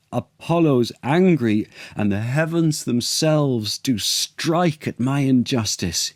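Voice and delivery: a stricken king crying out his guilt; anguished cry. Acted. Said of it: "he cried".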